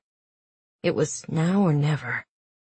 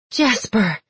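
higher relieved gasp; gasp of relief; 5 semitones higher than usual. Acted. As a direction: breathe his name in relief. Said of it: "she gasped in relief".

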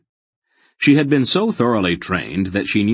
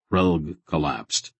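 usual speaking voice

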